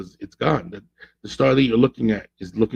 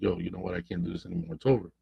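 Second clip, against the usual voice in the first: softer, a weary woman ending it; quiet goodbye; 3 semitones lower, 12 dB quieter, faster than usual. Retold or imitated. Coach voicing the girlfriend who walks out, quiet and resigned. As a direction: say it low-key and drained, done.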